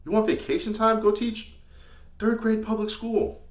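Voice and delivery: high-pitched derisive jab; scornful mockery. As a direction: pitch it way up and jeer. Mocking those wanting vacation.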